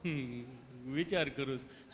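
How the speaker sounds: low-pitched, smitten patient; bashful low reply. Acted. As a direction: answer low, charmed by the nurse. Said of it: "તમે કેટલું પ્રેમથી પૂછો છો".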